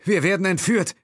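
high, loud cry of alarm; alarmed shout. Acted. Will realising they are being kidnapped, shouting in alarm. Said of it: "rief er".

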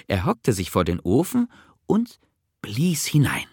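ordinary delivery, nothing marked

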